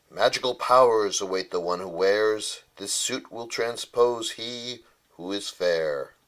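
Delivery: ordinary delivery, nothing marked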